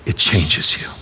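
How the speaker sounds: soft whispered It changes you. Acted. Preacher closing the point to the congregation, whispered. Preacher repeats key line.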